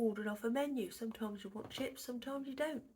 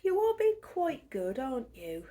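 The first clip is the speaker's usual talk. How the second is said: high, slow sweet pet voice; talking to pet; 3 semitones higher, 8 dB louder than usual. Real, not acted. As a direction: coo at the cat lovingly. Speaking to her cat.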